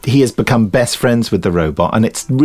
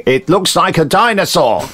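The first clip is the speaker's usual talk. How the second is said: high put-on voice, stressing dinosaur; dubbed bloke's line; 7 semitones higher than usual. The narrator retells the film dub in a high put-on voice. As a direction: quote the dub clearly, landing on dinosaur. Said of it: "You just heard the bloke saying".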